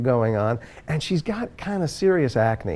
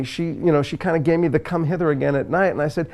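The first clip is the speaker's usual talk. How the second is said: ordinary delivery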